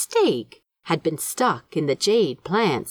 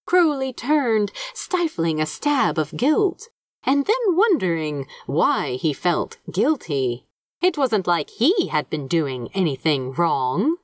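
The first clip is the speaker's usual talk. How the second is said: ordinary delivery, nothing marked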